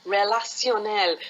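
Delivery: put-on French accent, landing on the final syllable. Helper translates for a workshop teacher, French accent. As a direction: pronounce it as a French word. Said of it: "say it in a French accent".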